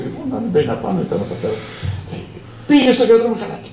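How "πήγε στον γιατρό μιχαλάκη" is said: anxious high. Retold or imitated. Boss asks anxiously.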